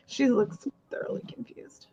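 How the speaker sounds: soft whispered aside. Real, not acted. Whispering an aside to the chat about her friend, whisper. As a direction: whisper it behind a hand.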